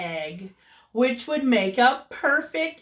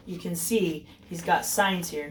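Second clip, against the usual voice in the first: ordinary delivery, nothing marked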